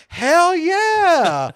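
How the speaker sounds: high, loud whoop; over-the-top gleeful yell; excited retold cheer. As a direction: holler it with huge enthusiasm. Retold excited answer at dinner.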